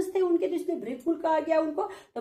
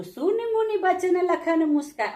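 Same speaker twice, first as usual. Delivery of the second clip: verse recitation; 4 semitones higher than usual. Shown as a demonstration.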